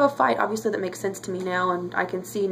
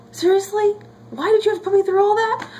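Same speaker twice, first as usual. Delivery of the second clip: exasperated outburst; 12 semitones higher, 5 dB louder than usual. Real, not acted. Exasperated at the filmmakers.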